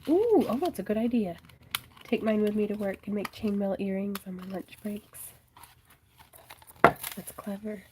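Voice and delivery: ordinary delivery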